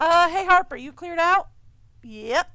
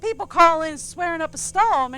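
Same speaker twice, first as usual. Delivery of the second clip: ordinary talk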